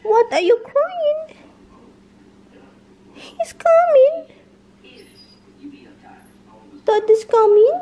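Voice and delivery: very high sing-song pet voice. Real, not acted. Baby-talking to the whining dog about Dada.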